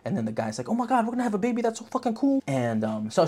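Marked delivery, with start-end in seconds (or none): 0.7-2.4 mock excited guy. Voicing the excited boyfriend.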